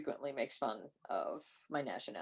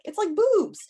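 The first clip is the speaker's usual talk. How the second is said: playful high squeal; 14 semitones higher, 7 dB louder, slower than usual. Retold or imitated. Retold name trick.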